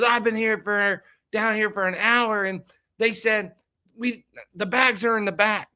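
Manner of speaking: high-pitched, agitated retelling of a stranded passenger; imitated worked-up complaint. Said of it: "They're screaming".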